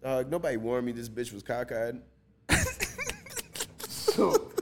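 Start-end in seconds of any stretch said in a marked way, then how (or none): 2.5-3.8 real helpless laughter; high shrieking laugh. Real, not acted. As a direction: laugh way up high, almost squealing. Laughing at the cockeyed counselor.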